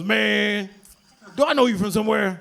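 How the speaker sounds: a hint of a laid-back older guy; casual, drawn-in uncle voice; lightly played street uncle; lightly voiced uncle. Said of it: "He goes".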